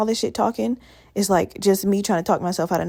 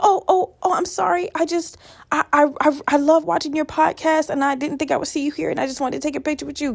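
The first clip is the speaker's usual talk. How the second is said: lightly voiced flustered fan, higher; nervous fan; 6 semitones higher than usual. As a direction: mimic a shy fan stumbling through an apology. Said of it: "she was like".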